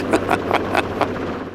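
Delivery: soft laugh at own joke; announcer's chuckle. Acted. Announcer jokes about his wife's credit card, chuckling. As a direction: chuckle softly, pleased with the quip.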